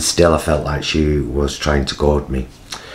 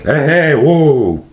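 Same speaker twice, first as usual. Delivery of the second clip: loud, raised blokey hollering; 10 semitones higher, 5 dB louder, much slower than usual. Retold or imitated. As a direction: imitate a boisterous bloke calling out. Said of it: "he was a very blokey".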